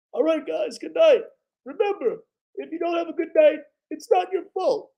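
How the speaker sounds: very high, thin coach voice signing off; Doc Rivers impression. Vlogger bids viewers goodnight as Doc Rivers, very high and thin. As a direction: keep the squeaky coach voice for the goodnight. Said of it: "That's Doc Rivers".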